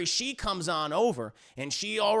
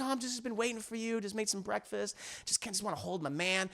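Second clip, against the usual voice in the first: soft, hushed wifely cooing; mock sweet talk; 10 dB quieter, faster than usual. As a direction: sweet-talk the husband quietly. Wife sweet-talks husband.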